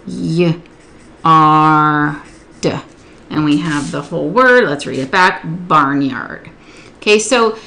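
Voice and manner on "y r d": YARD sounded out as Y, long AR, D; segmented sounds. Shown as a demonstration. Tutor modelling say and write yard, each sound apart. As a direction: split "yard" into three sounds with gaps. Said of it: "They need to hear it segmented".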